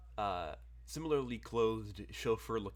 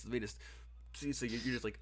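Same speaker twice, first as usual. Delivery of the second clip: ordinary talk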